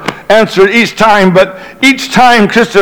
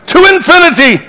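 high-pitched mock rallying cry; imitated shout; 10 semitones higher, faster than usual. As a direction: call it out high, like Buzz Lightyear's catchphrase. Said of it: "if someone shouted".

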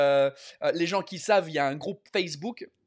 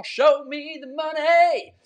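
movie quote shout; 8 semitones higher, 6 dB louder, much slower than usual. Pretend. Quoting a movie line.

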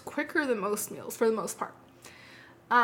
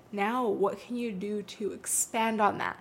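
ordinary delivery

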